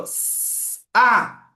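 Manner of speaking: hissed "s", stop, then loud high "a"; two separate sounds, "s" and a strong "a"; paused between sounds. Retold or imitated. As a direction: imitate the child breaking "s" off before "a". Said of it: "Que ela para".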